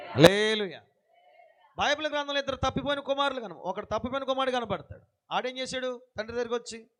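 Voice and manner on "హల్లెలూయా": a louder, ringing హల్లెలూయా; loud hallelujah. Real, not acted. Preacher leading the crowd, a loud హల్లెలూయా. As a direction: call out హల్లెలూయా with full voice. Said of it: "హల్లెలూయా చెప్పాలి".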